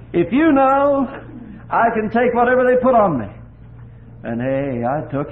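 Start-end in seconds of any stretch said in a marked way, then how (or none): 0.1-3.3 much higher and louder impassioned cry; the repeat swelling to a high, loud cry of trust. Retold or imitated. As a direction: shout the vow to heaven. Prayer through tears, repeated climax.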